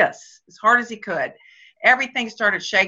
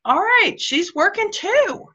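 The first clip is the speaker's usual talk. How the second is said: husband conceding; 10 semitones higher than usual. Retold or imitated. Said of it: "to understand that".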